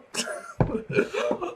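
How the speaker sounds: high breathless wheeze of laughter; wheezing laugh. Real, not acted. Reader wheezes with laughter at the whiplash. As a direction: lose it laughing silently. Cracking up at the page.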